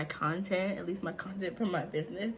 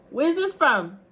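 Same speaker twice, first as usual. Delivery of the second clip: loud, high shouted question; calling out; 8 semitones higher, 8 dB louder, slower than usual. Real, not acted. Quizzing her son from afar, calling out. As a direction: project it to another room.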